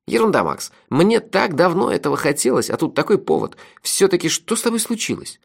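high-pitched, animated friend's voice; lively sidekick, dismissive then curious. Acted. Narrator voices the friend Melifaro. (высокий голос бойкого напарника)

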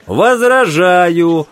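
loud drawn-out objection; shouted objection. A narrator's reading. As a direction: leap up and shout it out. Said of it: "вскричал О'Брайен".

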